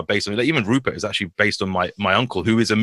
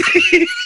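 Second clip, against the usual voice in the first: high squeal laugh; 3 dB louder than usual. Real, not acted. Laughing at his dad.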